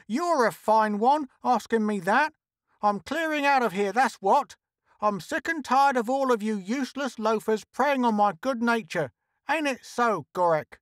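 high, indignant character voice; thundering. A narrator's reading. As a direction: rant in a high, fed-up voice. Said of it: "she thundered".

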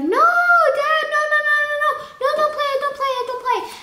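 put-on high, loud panicked kid's protest; mock-terrified wail at dad; retold pleading. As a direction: beg frantically at a high pitch, acting it out. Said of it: "I'd be like".

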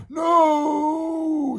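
very high, loud, long wail; horrified shout. Acted. Twins awash with slime, horrified shout. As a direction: scream it out in dismay. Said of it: "shouted the pair".

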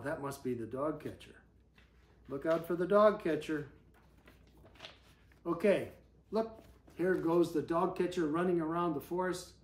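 ordinary delivery

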